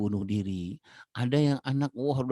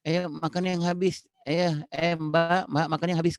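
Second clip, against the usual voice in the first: mock nagging parent; 6 semitones higher than usual. Parent nagging child to eat.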